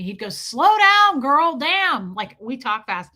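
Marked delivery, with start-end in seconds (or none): 0.3-2.1 loud, high-pitched complaint; raised-voice mock protest; imitated exasperation. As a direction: mimic someone telling a fast talker off. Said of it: "Fred used to do that all the time".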